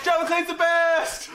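high, slow, loud gush; hammed-up shout of praise; mock cheering. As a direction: draw it out in a squealing cheer.

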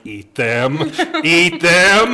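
loud, rising mom voice pushing; a mother forcing toys on her son; menacing mom. Retold or imitated. Mom at breakfast table insisting, loud mock menace. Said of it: "his mom is like".

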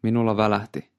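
ordinary delivery

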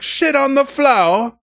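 mock-horrified passenger; imitating the passenger. Passenger reacting to the toilet mess, mock-horrified high voice. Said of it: "but he's like".

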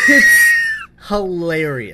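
loud, drawn out through laughter; laughing hard. Real, not acted. Cohost disagreeing that it's awful, loudly laughing. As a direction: burst out laughing into the words. Cracking up over the awful scene.

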